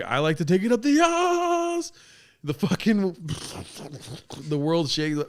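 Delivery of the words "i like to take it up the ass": put-on much higher, falsetto girl voice; Ashley's answer. Imagining Ashley answering the podcast question, high falsetto. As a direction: squeal it like a proud confession. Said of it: "She's like".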